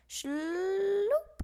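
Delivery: long rising shloop noise; dragging shloop. A narrator's reading. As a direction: drag the sound out. Sack dragging sound.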